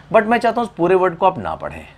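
ordinary talk

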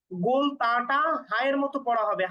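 normal talking voice

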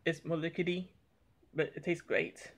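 ordinary talk, nothing marked

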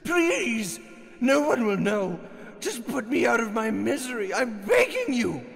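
desperate begging. Acted. Old man begs to be killed.

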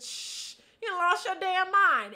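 loud, indignant scolding; angry scolding. Retold or imitated. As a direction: tell him off, outraged. Retells scolding the sneezing man.

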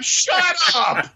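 high mock shout; mock yell. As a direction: yell at the spoiler. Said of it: "you're like".

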